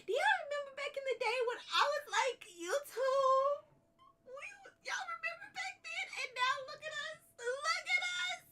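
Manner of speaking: overwhelmed with pride, laughing through tears; emotional joy. Real, not acted. Streamer remembering her early days, laughing close to tears. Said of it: "I'm gonna cry".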